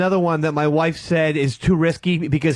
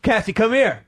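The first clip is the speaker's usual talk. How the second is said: higher-pitched pretend summons; test-calling a child; 5 semitones higher than usual. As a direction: call the kid over, trying out the name. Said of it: "That's how I always test out a name".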